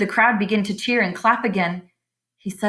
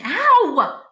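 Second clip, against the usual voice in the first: much higher sharp yelp; scream of pain; 11 semitones higher, 4 dB louder than usual. Acted. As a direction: yell as the tail is bitten. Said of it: "he screamed".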